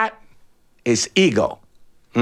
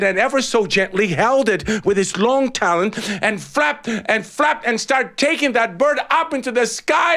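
usual speaking voice

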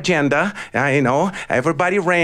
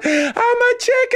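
much higher, shrieked cry; panicked shout. A narrator's reading. Chicken falling through the air, shrieking. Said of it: "the bird was".

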